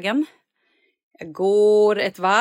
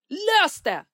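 sharp order; 10 semitones higher than usual. Retold or imitated. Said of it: "så argt".

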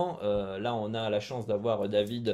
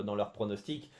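usual speaking voice

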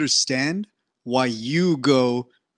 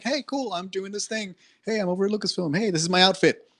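mock cheery poster; 5 semitones higher than usual. Actress posting updates online, a lightly mocked bubbly cheer. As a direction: chirp it like a bubbly Instagram post. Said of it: "she was posting, like".

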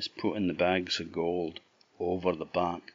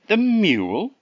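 surprised; 14 semitones higher, 8 dB louder than usual. Acted. Green Man surprised at mule choice.

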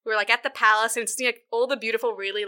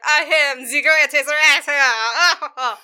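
put-on loud, slow drunken bellow; drunken boasting; 4 semitones higher, 7 dB louder, much slower than usual. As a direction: bellow it grandly, dragging the words. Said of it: "he's super drunk".

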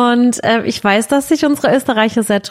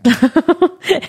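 bright amused laugh; real laugh; 8 semitones higher than usual. Real, not acted. Laughs at crying partner.

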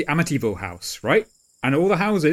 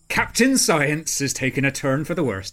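ordinary talk, nothing marked

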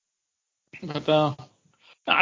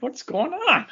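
puzzled; 11 semitones higher, 3 dB quieter than usual. Retold or imitated. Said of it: "I'm like".

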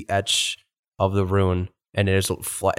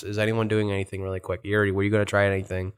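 ordinary talk, nothing marked

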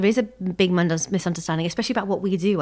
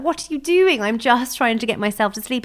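raised, indignant mock protest; playful, higher-pitched exasperation; mock irritation; 5 semitones higher, 5 dB louder than usual. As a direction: act fed up with a pestering partner. Acting out irritation at husband's patting.

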